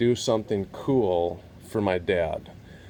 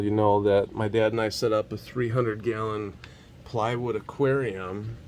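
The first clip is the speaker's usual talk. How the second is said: ordinary delivery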